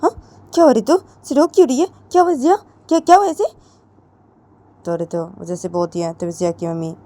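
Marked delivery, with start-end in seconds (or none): 0.0-3.4 worried friend. Acted. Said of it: "Sneha वो रितु को बोलती".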